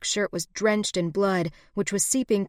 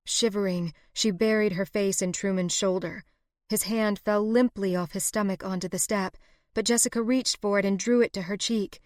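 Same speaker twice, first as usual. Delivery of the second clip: ordinary delivery, nothing marked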